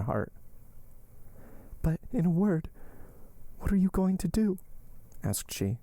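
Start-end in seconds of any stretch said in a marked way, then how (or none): 1.8-4.6 high-pitched worried question; anxious Valentine. Acted. Valentine asking what Morrel intends, anxious. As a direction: ask high, anxious for his answer.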